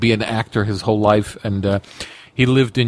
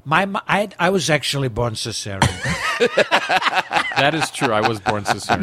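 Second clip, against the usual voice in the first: Trump voice; 3 semitones higher than usual. Pretend. Trump riff on big hands.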